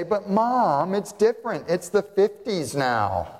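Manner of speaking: hint of a teenager arguing; whiny kid. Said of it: "Did kids, uh, half a century ago say".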